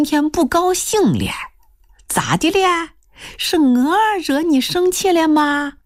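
high, drawn-out, teasing imitation of a son-in-law; imitated cajoling son-in-law. Mother mimicking the would-be son-in-law wheedling her, high and drawn-out. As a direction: play the sweet-talking suitor, slow and high-pitched. 娘学新女婿上门的油嘴话.

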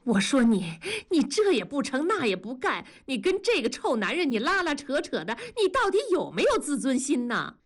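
high, frustrated rebuke; exasperated scolding. A narrator's reading. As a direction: scold her like a disappointed sister. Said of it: "恨铁不成钢地说".

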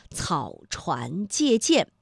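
草船借箭 slowly, each initial distinct; careful initials. Shown as a demonstration. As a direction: step through 草船借箭 one syllable at a time. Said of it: "声母的连读啊". (慢速逐字读清“草船借箭”的声母)

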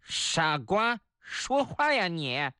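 put-on talking-bird voice, drawn out; parrot voice. Parrot at bird market scolding the staring customer, parrot voice. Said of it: "鹦鹉开口了".

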